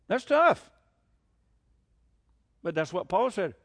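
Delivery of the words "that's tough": emphatic exclamation. Real, not acted. Preacher reacting to grief.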